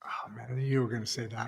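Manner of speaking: breathy whispered joke to himself; whispered aside. Real, not acted. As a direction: drop to a hushed aside, no voice. Co-host's aside to the speaker.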